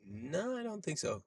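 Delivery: high, very soft mock denial; sheepish denial. Answering friend's accent question, high soft denial.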